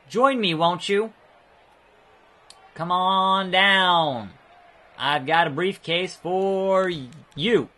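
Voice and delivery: slow, drawn-out mock invitation; playful beckoning. Player luring Kevin Owens toward a briefcase, playfully beckoning. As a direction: call out slowly, stretching the words. Playfully lures game opponent.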